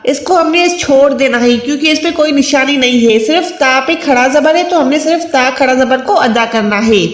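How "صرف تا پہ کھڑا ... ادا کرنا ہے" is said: ordinary talk, nothing marked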